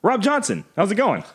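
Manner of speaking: high, sing-out hello to a coworker; playful greeting. Acted. Answering the work phone, playful high-pitched greeting.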